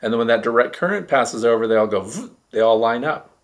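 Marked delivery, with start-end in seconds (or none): 2.0-2.4 quick vroom, swooping upward; imitated vroom. Shown as a demonstration. Showing how particles all line up, imitated vroom. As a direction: make a rising whoosh noise.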